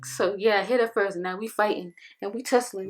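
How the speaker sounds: normal talking voice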